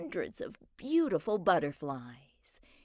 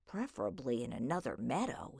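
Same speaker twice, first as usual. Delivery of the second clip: lower, softer, fast aside; muttered under breath; 7 semitones lower, 7 dB quieter, faster than usual. A narrator's reading. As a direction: grumble it under your breath. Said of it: "he muttered quietly under his breath".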